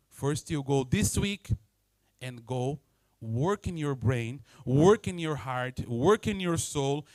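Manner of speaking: slow, stressing each work; deliberate emphasis. Real, not acted. The trainer gives homework, slow and emphatic. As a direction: lay down each work slowly. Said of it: "trainer emphatic instruction".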